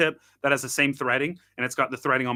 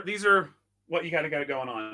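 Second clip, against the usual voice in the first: usual speaking voice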